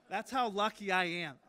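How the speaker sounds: chuckling at his luck; laughing. Real, not acted. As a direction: say it while still laughing. Laughing after the story.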